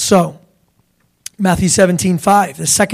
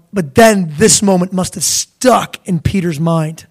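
usual speaking voice

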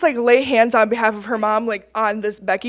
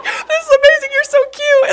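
very high, loud gushing through laughter; mock bawling; 4 dB louder than usual. Praying woman to a tearful dad, high mock bawling. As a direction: imitate yourself bawling, squeaky-high and laughing. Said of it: "and I'm bawling".

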